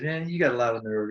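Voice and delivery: ordinary talk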